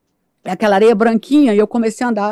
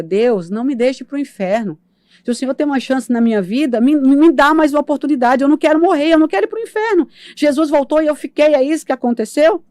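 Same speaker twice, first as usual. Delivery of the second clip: desperate pleading; 6 semitones higher than usual. Retold or imitated. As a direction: beg God for your life, rising to a cry. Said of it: "Eu dei um grito de desespero". (súplica desesperada crescente)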